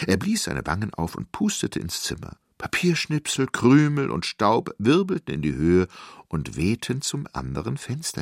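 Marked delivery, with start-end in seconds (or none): none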